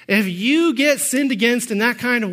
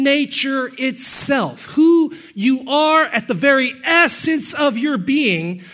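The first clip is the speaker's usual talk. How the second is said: raised, driving pulpit voice, punching key words like human and sinner; fervent preaching; 4 semitones higher than usual. Real, not acted. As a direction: preach it hard to the congregation. Preaching doctrine at full force.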